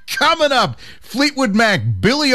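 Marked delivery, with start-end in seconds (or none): 0.0-0.8 laughing. Real, not acted. Cracking up before song list.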